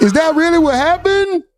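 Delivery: high, stunned disbelief; real shock at the chat's gossip. Real, not acted. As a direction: react like the gossip just floored you.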